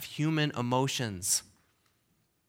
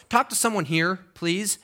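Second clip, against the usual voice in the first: ordinary talk, nothing marked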